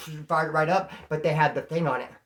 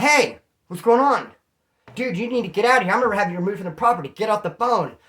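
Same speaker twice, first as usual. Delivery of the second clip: angry coworker; 4 semitones higher, 6 dB louder than usual. Retold or imitated. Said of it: "Dude's like".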